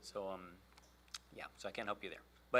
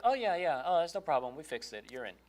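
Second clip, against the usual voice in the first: bright, much higher, louder "oh yeah, no problem"; cheery support rep; 4 semitones higher, 7 dB louder than usual. Retold or imitated. Walmart agent telling the seller it's fixed, higher and upbeat. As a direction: play the breezy helpful phone agent.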